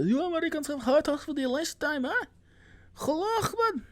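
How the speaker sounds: very high, strained villain voice; cartoonish high-pitched terrorist impression; caricature foreign villain. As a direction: threaten in a hammed-up foreign villain voice. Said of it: "all my villains are brown".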